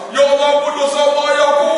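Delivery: acted tearful cry to God; imitated wailing prayer. Acts out the man's wailed prayer.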